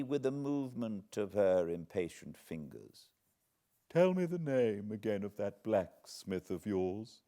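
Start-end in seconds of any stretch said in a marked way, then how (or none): 3.9-7.2 lightly voiced imperious old lady; a hint of a commanding old woman. Miss Havisham questions Pip.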